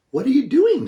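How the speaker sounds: put-on high, bright, curious girl's question; curious princess. A narrator's reading. Princess asks the old woman, curious and high. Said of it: "the princess asked curiously".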